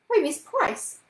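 high, loud, dismayed cry; unhappy exclamation. A narrator's reading. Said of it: "she exclaimed unhappily".

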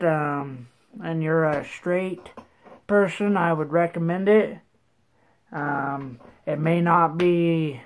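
slightly muffled lip sounds; talking around a dip. Real, not acted. Seen 1: Lower lip looks bulged throughout; tin of dip shown at 9.9.

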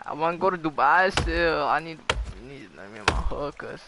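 normal talking voice

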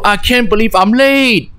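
lightly acted fluster of a late student; mild panic. Game player voices a student late for an exam, mild panic. Reading main character's line aloud, running late.

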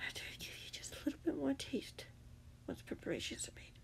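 very soft, hushed villain whisper; whispered threat. Acted. The captor readies another potion, whispering menacingly.

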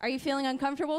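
ordinary talk, nothing marked

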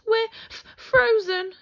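high, loud stutter from the cold; shivering. A narrator's reading. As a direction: stammer through chattering cold. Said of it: "two shivering rabbits".

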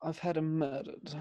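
slow, quiet deadpan line; dry menacing joke. Pretend. Friend jokes about old schoolmate, deadpan.

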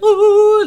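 very high, loud heavenly choir ooh; angelic ooh. Retold or imitated. Friend walks through the doors, a loud high angelic ooh. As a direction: sing it like angels arriving.

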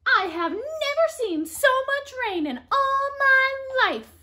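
high, loud piggy voice complaining; upset Piggy. Acted. Piggy protesting the rain, high and loud. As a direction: wail about the rain like a cross little pig.

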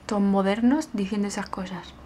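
normal talking voice

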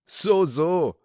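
high, amused exclamation; amused chuckling. Acted. Rider reacting to the old man's joke, amused. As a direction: toss it off as if laughing. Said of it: "lachte der Reiter".

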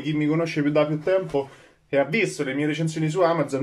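ordinary talk

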